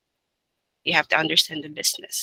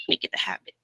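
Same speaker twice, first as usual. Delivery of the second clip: whispering; 7 dB quieter, faster than usual. Real, not acted. Whispered aside to the team.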